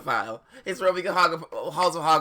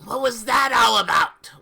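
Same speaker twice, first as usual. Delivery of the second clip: loud, high demanding yell; hammed-up outraged shout; mock shout; 7 semitones higher, 7 dB louder than usual. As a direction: bellow the question at them.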